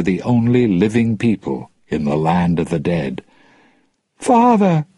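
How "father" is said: crying out. Acted. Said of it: "she cried".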